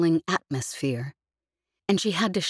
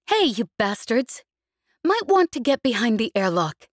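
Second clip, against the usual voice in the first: raised, higher voice calling across; 6 semitones higher than usual. A narrator's reading. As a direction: call out over a line to others. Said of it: "Jez called over the general comm line".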